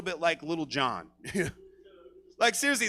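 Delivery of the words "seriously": ordinary delivery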